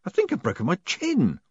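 high, emphatic complaint; said aloud in pain. A narrator's reading. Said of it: "The old man hissed".

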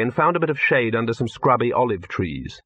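plain reading voice

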